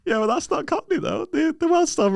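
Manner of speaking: high, disbelieving objection; incredulous. Real, not acted. Objecting to the Cockney impression, high and disbelieving. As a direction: squeak it out in disbelief.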